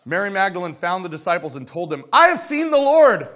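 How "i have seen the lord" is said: high, loud exclaiming; overjoyed witness; excited announcement. Acted. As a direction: burst out with the news. Mary tells disciples, excited.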